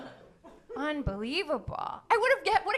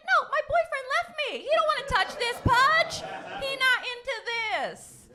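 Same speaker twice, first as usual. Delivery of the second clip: much higher, whiny complaint; mock whine; 10 semitones higher, 3 dB louder than usual. Patient whining to doctor about boyfriend, high mock whine. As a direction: whine it like a jilted girlfriend. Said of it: "I'm like".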